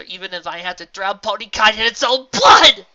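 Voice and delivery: loud, high furious threat. Acted. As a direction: let the threat explode. Menacing escalating threat.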